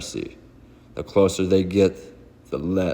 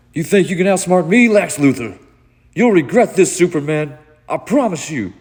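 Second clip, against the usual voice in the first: much higher, much louder enraged threat; furious villain; 11 semitones higher, 8 dB louder than usual. Acted. Trapped villain yelling vengeance at Superman, furious shout. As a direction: scream the threat through rage.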